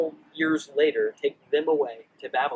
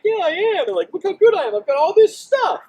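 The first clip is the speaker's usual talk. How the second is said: very high, loud mock bragging; boastful brag; 9 dB louder than usual. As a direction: crow about your riches. Hezekiah bragging to the envoys.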